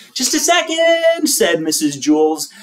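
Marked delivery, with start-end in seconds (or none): 0.1-1.3 high teacher voice. A narrator's reading. Teacher answers Lewis.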